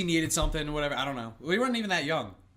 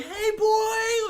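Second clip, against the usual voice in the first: high, bright shout to the dog; excited greeting; 3 dB louder than usual. Retold or imitated. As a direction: pump up the welcome.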